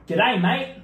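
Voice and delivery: bright-pitched Australian greeting; Australian accent. Acted. Partner gives a clue to guess, Australian accent. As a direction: say it like a caricature Aussie.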